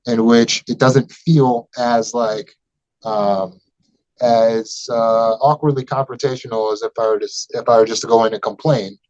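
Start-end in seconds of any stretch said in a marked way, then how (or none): none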